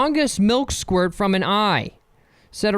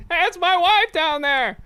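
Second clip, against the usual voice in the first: high, chipper everyman voice; 11 semitones higher than usual. Pretend. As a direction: say it like a cheerful regular guy.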